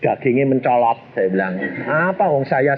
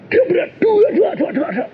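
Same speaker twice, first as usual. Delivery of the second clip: crash noise. Retold or imitated. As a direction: mimic the crash, laughing. Imitating crash sound. (menirukan suara tabrakan)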